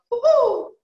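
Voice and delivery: high, loud owl woo-hoo; demonstrating the owl sound for the class; owl hoot. Shown as a demonstration. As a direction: hoot up in the head voice. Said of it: "we're going to make an owl sound".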